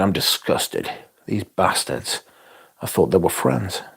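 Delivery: hushed, hammed-up wounded disgust; mock disgusted friend. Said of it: "he's gonna be like".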